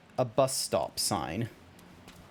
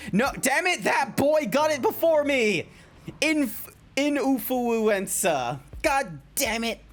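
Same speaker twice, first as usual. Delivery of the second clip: high-pitched annoyed ranting; whiny frustrated yelling; frustrated high outburst; 8 semitones higher than usual. Real, not acted.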